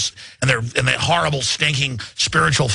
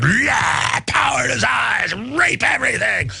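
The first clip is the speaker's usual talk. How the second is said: mock villain gloat; 6 semitones higher, slower than usual. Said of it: "Just, just like".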